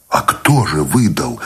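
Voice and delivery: plain reading voice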